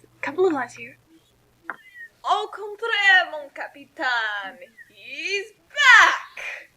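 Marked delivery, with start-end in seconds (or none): 2.2-6.7 high, slow, mocking French-flavoured taunt. Q taunting the captain in mock French. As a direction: tease the captain grandly. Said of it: "I need to get into character as him".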